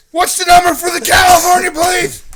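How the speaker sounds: high, very loud frantic question; retold screaming. Stranger handed a phone by scared teens, screaming. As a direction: shout the question like the crazed man. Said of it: "He kept screaming, screaming".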